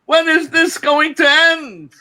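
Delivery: loud, very high-pitched wail as the wife; exasperated wife's cry. Acted. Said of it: "Every day she says".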